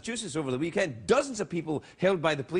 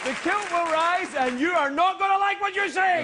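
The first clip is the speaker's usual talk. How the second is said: mock war cry; 10 semitones higher than usual. Hammed-up rallying threat.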